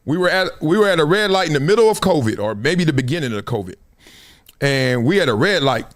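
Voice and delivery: ordinary talk, nothing marked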